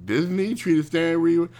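high incredulous "Really?"; sarcastic surprised question; mock disbelief. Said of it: "And I was like".

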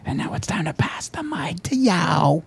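high, drawn-out rap hype shout-out. Acted. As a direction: stretch it out like a hammed-up rap handoff. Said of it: "gonna try to Beastie Boy ram that".

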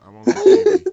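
put-on squeaky high baby voice, laughing. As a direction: whine it like a tiny baby.

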